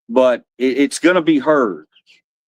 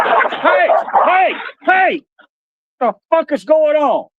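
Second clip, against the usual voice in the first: real shout. Real, not acted. Yelling at his dogs fighting outside, real shout. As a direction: bark it out to stop them cold. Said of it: "daddy raises his voice like that".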